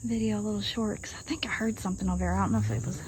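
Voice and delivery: real whisper. Real, not acted. Vlogger hears a noise off camera, whispering. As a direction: drop to a whisper, spooked.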